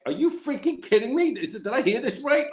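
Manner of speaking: high, incredulous outburst; shocked phone call to a retiring buddy; disbelief. Retold or imitated. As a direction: sound like you can't believe the news. Reacting to friend's retirement.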